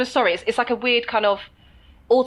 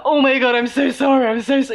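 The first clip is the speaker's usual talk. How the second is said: gushing over-apology; 5 semitones higher, 6 dB louder than usual. Retold or imitated. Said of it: "it's not from that place".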